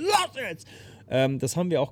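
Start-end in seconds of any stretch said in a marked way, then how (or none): 0.0-0.7 hoher, anfeuernder Bühnenruf; anfeuernder Ruf. Retold or imitated. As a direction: treib die Menge an. Sängerin feuert Publikum an. (Sängerin feuert die Menge an, hoch und anfeuernd)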